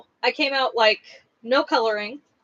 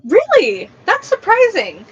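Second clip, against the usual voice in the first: high, loud surprise; surprised; 8 semitones higher, 8 dB louder, slower than usual. Real, not acted. Streamer reacting to a viewer's news about tattoos, surprised. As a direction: react with real surprise, pitch leaping up.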